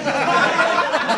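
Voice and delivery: big loud laugh bursting out; loud burst of laughter. Real, not acted. Teller bursting out laughing at his own story. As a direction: crack up loudly at the reveal.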